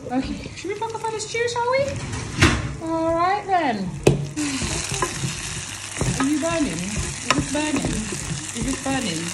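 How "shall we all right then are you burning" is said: usual speaking voice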